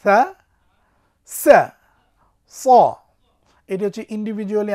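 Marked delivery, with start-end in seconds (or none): 0.0-2.7 সা light, সা with a hiss, স heavy and full; letter drill. Shown as a demonstration. Teacher drills Arabic letters for students, loud and clear. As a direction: say সা, সা, স as three distinct letters. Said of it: "আরেকবার".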